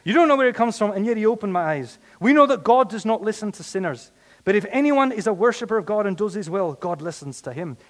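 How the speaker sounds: high, loud incredulous retort; emphatic comeback. Acted. Healed man argues back at accusers, loud high retort. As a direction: argue back with full dramatic force.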